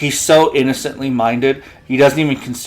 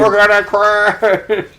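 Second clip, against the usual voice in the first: very slow, higher mock panic; drawn-out doom; 8 semitones higher, 3 dB louder, much slower than usual. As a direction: stretch it out like a dying robot. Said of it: "playful crash line".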